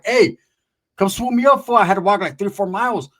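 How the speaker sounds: loud, high insistent call; loud demand. Retold or imitated. To the homeboy on the phone, a loud annoyed demand.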